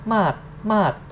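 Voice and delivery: "mat" said with a high Thai tone; Thai word mat, high tone. Shown as a demonstration. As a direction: mat wyraźnie wyżej. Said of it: "so it's like mat".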